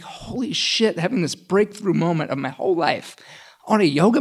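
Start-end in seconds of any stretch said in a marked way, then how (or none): 0.0-1.0 much higher, awestruck exclamation; amazed. Retold or imitated. Breakthrough on the yoga mat, awestruck and high. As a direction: gasp it out in sudden wonder. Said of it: "I was just like".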